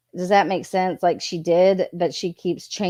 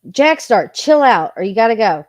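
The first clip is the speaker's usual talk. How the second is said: firm, louder call-out to a chatter; loud warning; 3 semitones higher, 8 dB louder than usual. Real, not acted. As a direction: warn him off, louder and sterner.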